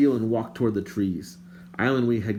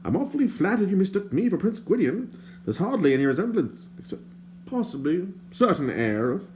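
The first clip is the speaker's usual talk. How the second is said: higher, prim and indignant stranger; lightly voiced fussy stranger; 10 semitones higher than usual. A narrator's reading. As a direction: play the fussy bard, put out.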